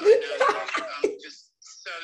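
bursting into a loud laugh; words broken by laughter. Real, not acted.

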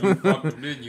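high and loud mock accusation; wife teasing husband, loud high accusation. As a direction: accuse him loudly, half joking. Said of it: "She was like".

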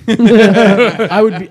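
laughing through the words. Real, not acted. Host laughs about getting rick rolled, laughing. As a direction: let the words ride on a laugh.

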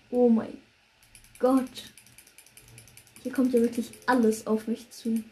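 loud alarmed outburst; panicked shout. Real, not acted. Mobs everywhere, panic. (panischer Ausruf)

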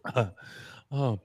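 ordinary delivery

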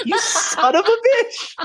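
very high, slow laughing yell; laughing outburst. Retold or imitated. Player whose loot was stolen by Bill, laughing yell. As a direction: shriek an insult through laughter. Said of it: "And I'm like".

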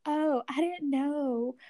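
drawn-out realization, gently teasing; clueless friend imitation. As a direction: lightly mimic a friend who missed the joke.

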